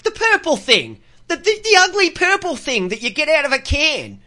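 high-pitched exasperated retort; exasperated explanation. Retold or imitated. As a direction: insist as if it were obvious. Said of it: "And I went".

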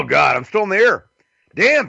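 normal talking voice

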